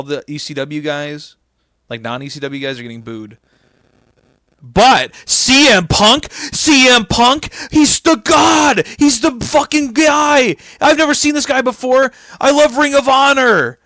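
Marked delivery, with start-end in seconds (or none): 4.6-13.8 high, slow, louder gushing fan voice; mock adoring fan. Mocking the New York crowd for cheering Punk, high gushing fan voice. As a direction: gush like a starstruck crowd member.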